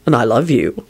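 low, understated endearment; boyfriend voice. A narrator's reading. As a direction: return the love in a low voice. Boyfriend returns her endearment.

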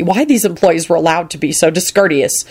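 usual speaking voice

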